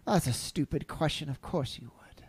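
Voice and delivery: hushed, knowing aside; whispered aside. Acted. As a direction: whisper it under the breath. Muttered aside to Karash.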